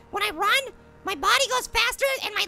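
ordinary talk

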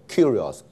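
curious with weight on "cu", falling away after; stress on first syllable. Shown as a demonstration. Teacher counts syllables from the back, first syllable of curious stressed. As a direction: lean on the front of curious. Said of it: "所以在前音节".

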